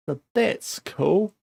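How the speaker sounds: slow, dry sarcastic drawl; deadpan sarcasm over a ruined season. Real, not acted. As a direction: drag it out as if it is anything but cool. Sarcasm after losing unbeaten season.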